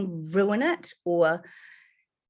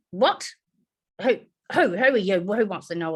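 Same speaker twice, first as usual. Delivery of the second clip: grumpy woman; 4 dB louder than usual. Retold or imitated. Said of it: "horrible woman".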